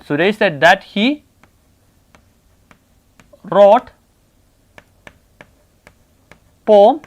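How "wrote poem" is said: one word at a time, long gaps; slow dictation. Real, not acted. Teacher writing the converted sentence for class, slow dictation. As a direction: dictate each word separately and wait.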